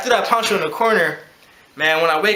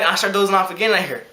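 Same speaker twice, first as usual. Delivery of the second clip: normal talking voice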